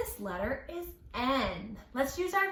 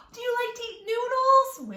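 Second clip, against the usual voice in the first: excited gasp; 12 semitones higher, 8 dB louder than usual. Acted. Excited about noodles with children.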